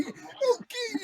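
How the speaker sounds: high strained growl-like singer voice; imitating singer's vocal. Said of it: "that obituary kind of vocal".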